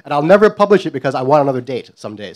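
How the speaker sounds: usual speaking voice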